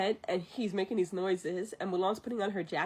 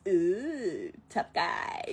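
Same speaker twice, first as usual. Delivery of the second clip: drawn-out sarcastic "ooh", kept low-key; mocking; 3 semitones lower, much slower than usual. Yao jeering at Shang's warning, mocking. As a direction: draw out the ooh, then toss off the jibe.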